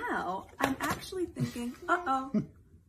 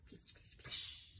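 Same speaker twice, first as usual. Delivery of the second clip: whisper; 16 dB quieter, slower than usual. Acted. Storybook page turn whisper.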